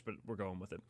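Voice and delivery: ordinary delivery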